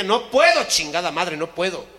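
loud, pained refusal; desperate shout. Acted. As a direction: yell it, cornered and desperate. Hombre desesperado grita. (grito desesperado)

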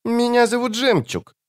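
put-on very high childlike creature voice; softly spoken child-voiced demon. A narrator's reading. Demon introduces itself to the wizard, high child voice. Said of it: "тихо проговорил демон".

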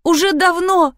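high plaintive complaint; a moaned, fearful admission; moaning. A narrator's reading. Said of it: "простонала я". (жалобно, высоким голосом)

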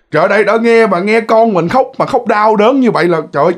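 ordinary delivery, nothing marked

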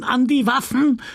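high shouted alarm cry; shouted call to arms. A narrator's reading. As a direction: yell it out high and urgent. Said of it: "Binet konnte gerade noch brüllen". (hoher Alarmruf)

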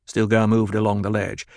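usual speaking voice

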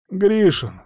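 put-on high-pitched young informer; answering lad. Beaten lad answers the questioning.

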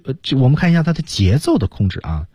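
normal talking voice

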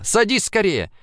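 high eager call. A narrator's reading. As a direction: urge him in quickly. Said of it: "крикнула".

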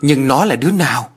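lightly acted impatient question. A narrator's reading. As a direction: press anxiously for an answer. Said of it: "Kim Thoa quá sốt ruột".

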